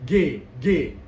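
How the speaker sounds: "G" firm, voiced in the jaw; G bergetar di rahang. Shown as a demonstration. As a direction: punch out "G" with vibration. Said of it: "ada getaran dalam rahangnya". ("G" dengan getaran rahang)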